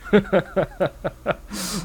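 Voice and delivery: long, high burst of laughter; high-pitched run of giggles; real giggling laugh. Real, not acted. Laughing at a retold giggling story.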